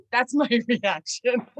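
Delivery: slow words broken by a laugh. Real, not acted. Owner owning up to her reaction, laughing. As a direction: laugh at yourself while admitting it.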